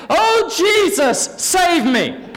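high, loud desperate shout; retold panicked plea as the car spins out; panicked cry. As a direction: cry out for help in terror. Girl cries out as car spins.

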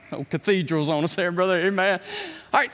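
ordinary talk

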